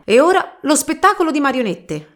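high, loud showman's announcement; grand announcement. Acted. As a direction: announce it like a circus master. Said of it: "annunciò il capo dei gitani".

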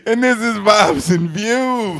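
slow, loud, high show announcement; radio intro voice. Pretend. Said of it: "that might be the new intro right there".

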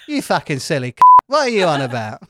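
lightly Cockney jibe; mock London bloke; Cockney banter. Shown as a demonstration. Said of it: "when I do that voice".

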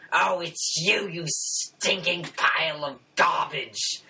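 high, slow growling monster insult; bugbear chief snarl. Acted. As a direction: snarl it like a brute tavern boss. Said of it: "the tavern's owner jumps out and says".

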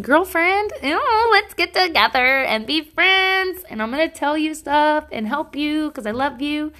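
high-pitched, mock-mushy gushing; gushy soft girlfriend. Host gushing to her listeners like girlfriends, playfully high and mushy. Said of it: "I'm feeling so soft and like".

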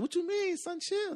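high, startled questioning; clueless kid. Retold or imitated. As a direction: chirp it out naive. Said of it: "I said".

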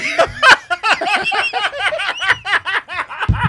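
rising helpless laughter; real uncontrollable laugh; long high laugh. Real, not acted. Friend who didn't talk.

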